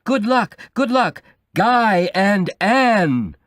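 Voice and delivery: high, loud chanted cheer, slowing at the end. A narrator's reading. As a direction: build the cheer up to a roar. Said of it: "The noise got louder and louder".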